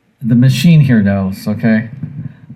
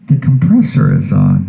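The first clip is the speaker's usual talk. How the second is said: ordinary talk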